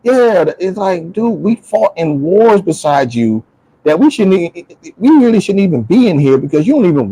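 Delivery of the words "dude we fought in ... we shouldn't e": usual speaking voice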